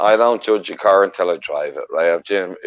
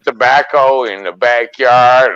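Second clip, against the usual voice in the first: slow Southern American Kentucky drawl; Kentucky accent; 4 semitones higher, slower than usual. Acted. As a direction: hold a slow hillbilly Kentucky voice, ham it up. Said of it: "you got a Kentucky accent going there".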